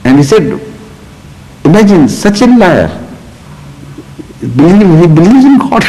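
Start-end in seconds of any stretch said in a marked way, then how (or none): 1.6-5.8 retold exasperation, raised pitch; mock incredulous indignation; exasperated. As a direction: imitate a man losing patience. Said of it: "got exasperated. He went, you know, absolutely, he was hitting the roof".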